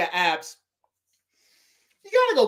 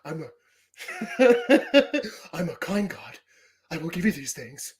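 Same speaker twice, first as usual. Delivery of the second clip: Xerxes impression; 5 semitones lower than usual. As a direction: purr it like a smug god-king. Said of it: "my impression of Xerxes".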